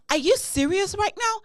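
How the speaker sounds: high, loud, incredulous retort; exasperated disbelief. Retold or imitated. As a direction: throw it back at him in disbelief. Retold fight with husband.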